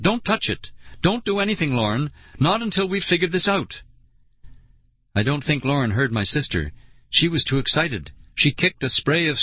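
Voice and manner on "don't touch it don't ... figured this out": high, urgent warning; anxious, firm telling-off. A narrator's reading. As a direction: warn her off, voice raised in pitch. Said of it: "Nicole warned".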